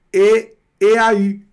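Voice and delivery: mock misreading, "E, A, U" pulled apart; wrong letter-by-letter reading. Shown as a demonstration. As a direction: read each letter of "E, A, U" on its own, the U as French ü. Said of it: "o francês não vai ler isso daqui".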